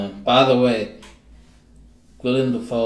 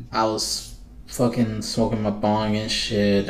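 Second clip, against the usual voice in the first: ordinary talk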